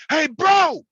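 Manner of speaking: high, loud yell of his brother's greeting; retold scream. Brother screaming a greeting down the phone, loud. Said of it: "I heard him scream".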